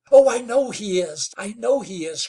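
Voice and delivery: high, loud eager cry; crying out eagerly. Acted. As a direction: burst out with hope. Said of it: "cried Nell".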